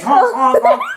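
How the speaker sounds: loud silly honking noises; silly goose honk. Pretend. Imitating a honking bird.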